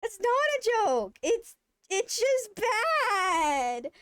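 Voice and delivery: high, loud, very slow, breaking into a cry; half-crying outrage. Retold or imitated. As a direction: wail it like you're about to sob. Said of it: "I'm fucking pissed at this point".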